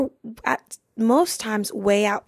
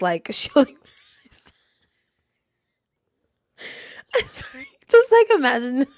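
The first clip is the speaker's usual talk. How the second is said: high, loud retelling bursting into laughter; laughing hard; 5 semitones higher, 5 dB louder than usual. Real, not acted. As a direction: push the words out while cracking up. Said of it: "laughing this hard".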